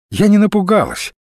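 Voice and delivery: high-pitched ironic denial. A narrator's reading. Olga denies she was frightened last night, ironic. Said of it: "с иронией заявила Ольга".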